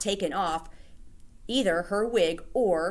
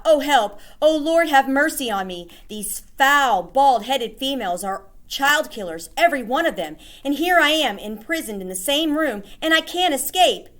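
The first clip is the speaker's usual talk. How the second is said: panicked inner plea; 7 semitones higher, 6 dB louder than usual. Acted. Boy panics hidden among witches.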